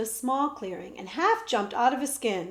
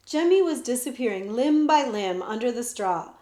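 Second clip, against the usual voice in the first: ordinary delivery, nothing marked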